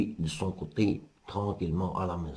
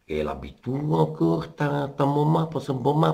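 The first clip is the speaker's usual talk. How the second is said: un loup qui joue l'innocent, voix un peu plus haute; loup mielleux; 7 semitones higher than usual. Acted. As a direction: flatte la petite pour la piéger. Le loup parle doucereusement. (loup mielleux, voix un peu plus aiguë)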